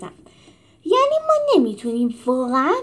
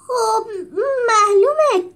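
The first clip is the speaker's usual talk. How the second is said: grumbling; 10 semitones higher, 6 dB louder, slower than usual. A narrator's reading. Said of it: "غر زد".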